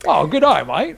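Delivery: swooping, hammed-up Aussie accent; Australian accent. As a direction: play up a broad Australian greeting. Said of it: "that was pretty Australian, actually".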